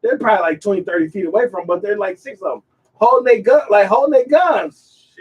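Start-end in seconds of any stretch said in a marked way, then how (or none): none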